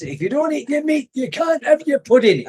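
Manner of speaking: high-pitched Scottish scolding quote; Scottish accent. Retold or imitated. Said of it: "had a Scottish accent".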